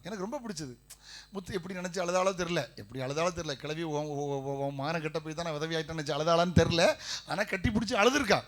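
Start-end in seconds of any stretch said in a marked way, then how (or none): none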